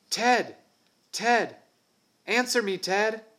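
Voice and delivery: high, loud shout into the jungle; shouting a name. Acted. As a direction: yell for the lost friend. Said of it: "Then he paused and shouted".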